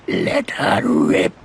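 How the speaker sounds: loud, slow and drawn out; bloodthirsty rallying cry. Acted. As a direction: relish every word at full voice. Ringleader urges slaughter.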